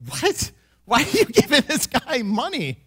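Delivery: high, incredulous mock gasp of a question; shocked wife. Wife reacting to husband refunding the buyer, incredulous. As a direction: imitate a stunned wife, a touch hammed. Wife hears he gave money.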